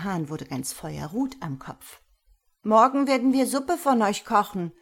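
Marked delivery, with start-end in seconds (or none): 2.6-4.7 put-on higher, louder threat from a boy; boy's threat. A narrator's reading. Hjalmar threatening the fowl, higher and louder. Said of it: "sagte Hjalmar".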